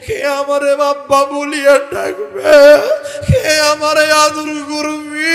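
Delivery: high, loud anguished call; wailing cry. Acted. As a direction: cry out in grief. High mourning wail. (উচ্চস্বরে কান্নাভরা ডাক)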